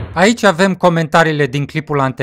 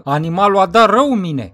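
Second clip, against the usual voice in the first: ordinary delivery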